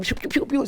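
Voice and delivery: high, light pew-pew noises; imitated toy-gun shots. Rabbi imitating a couple's Nerf fight, playful shooting noises.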